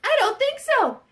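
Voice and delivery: high, loud goat refusal; defiant billy goat. Acted. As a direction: stand up to the troll, loud and high. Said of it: "the little billy goat said".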